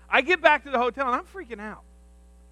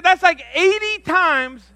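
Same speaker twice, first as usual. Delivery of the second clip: higher, louder and slow; mock outraged mom; 8 semitones higher, 7 dB louder, slower than usual. Mother reacting to the caffeine dose, higher, louder and slow. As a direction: stretch out the disbelief. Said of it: "She said".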